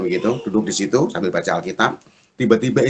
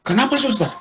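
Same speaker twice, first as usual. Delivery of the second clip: alarmed question; 13 semitones higher than usual. Retold or imitated. Alarmed question to nurse about wife.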